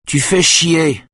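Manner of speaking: high sharp angry cry; shouting. A narrator's reading. Wife cursing at her drowsy husband, shouting. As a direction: snap it out at full voice. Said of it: "crie Simone".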